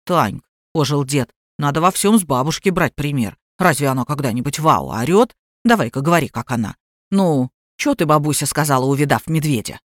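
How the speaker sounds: put-on low-pitched old grandad voice; grandfather, coaxing. A narrator's reading. Grandfather prompts granddaughter to copy grandma, low coaxing.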